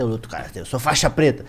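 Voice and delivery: muttered karate boast. Retold or imitated. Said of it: "Cê, cê luta karatê?".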